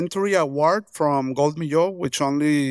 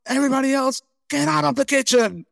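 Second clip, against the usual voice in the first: angry chef order; 7 semitones higher than usual. Retold or imitated. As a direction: play the chef throwing everyone out. Chef throws staff out of kitchen.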